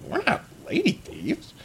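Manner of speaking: very high squeaky pleading; panicked goblin. Acted. Goblin begging not to be hurt, high panicked squeak. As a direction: cry out in fear.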